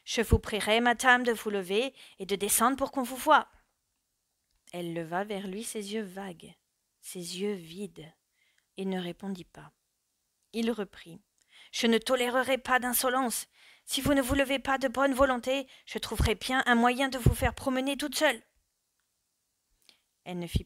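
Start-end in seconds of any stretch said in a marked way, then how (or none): 0.0-3.5 louder, curt officer's order; gruff officer demanding she get up; brusque command. A narrator's reading. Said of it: "d'un ton brusque". 11.7-18.4 higher and louder, furious officer; officer rages at the silent madwoman; raging threat. A narrator's reading. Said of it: "Il rageait".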